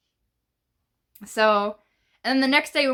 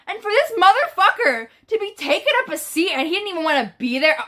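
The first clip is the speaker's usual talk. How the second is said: higher-pitched indignant rant; animated outrage, punching the grievance; offended; 7 semitones higher than usual. Retold or imitated. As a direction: retell it worked up and offended. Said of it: "I was offended, bro. I was so mad".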